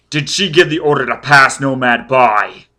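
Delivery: loud, forceful demand; shouting. A narrator's reading. Foyle pressing a frightened child for answers, shouting. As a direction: hammer the question out loudly. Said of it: "Foyle was shouting".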